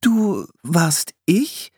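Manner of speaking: slow, high, baffled question; uncomprehending. A narrator's reading. Young woman echoing the girl's claim, baffled. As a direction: repeat it slowly in total puzzlement. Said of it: "wiederholte Priscilla verständnislos".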